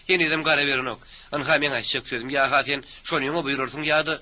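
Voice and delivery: high-pitched, irritated scolding; nagging wife's tirade. Acted. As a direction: keep the pitch up and rattle off grievances. Wife's angry grumbling at husband. (ýokary ses bilen käýinmek)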